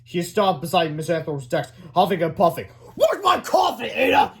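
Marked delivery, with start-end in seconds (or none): 3.0-4.3 high, loud bellow; angry shout. A narrator's reading. Principal at the secretary's desk, shouting. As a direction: yell it as a furious boss. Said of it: "he shouted".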